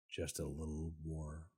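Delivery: low, hushed mock plea; soft coaxing. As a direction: coax quietly, as if asking a tiny favour. Repeats the plea more softly.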